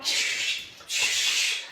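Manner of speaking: long hissed shush at a dog; forceful "shh"; sharp shushing. Real, not acted. Correcting the jumping dog live.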